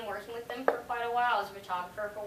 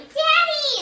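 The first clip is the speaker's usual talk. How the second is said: put-on shrill, much higher shouted call of a child; prank yell; 17 dB louder than usual. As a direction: yell it like a kid calling for dad.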